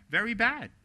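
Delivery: sharp preacher's scold; indignant rebuke. Real, not acted. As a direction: slam the verdict down. Indignant rebuke of center bashing.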